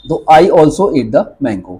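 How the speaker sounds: धीरे-धीरे साफ़ बोलकर; slow model sentence. Shown as a demonstration. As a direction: slowly dictate the English sentence. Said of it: "I पर जोर है". (धीमा, स्पष्ट उच्चारण)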